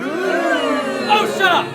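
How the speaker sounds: loud, high shout at hecklers; angry shout. Acted. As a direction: bark it at the rowdy crowd. Snapping at heckling audience.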